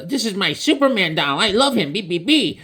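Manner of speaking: put-on high little boy voice; kid voice. Franklin showing off his Superman doll, high kid voice. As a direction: sound like a proud small kid.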